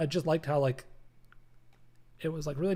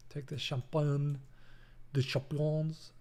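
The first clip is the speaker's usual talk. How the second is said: put-on French accent; slower than usual. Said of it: "my terrible French accents".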